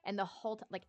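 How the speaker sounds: normal talking voice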